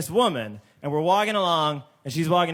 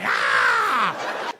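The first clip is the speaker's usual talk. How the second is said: high mock scream; frightened shriek; 13 semitones higher than usual. Woman on subway fleeing, high scream. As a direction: shriek like she's being chased. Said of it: "she's like".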